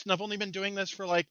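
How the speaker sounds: ordinary delivery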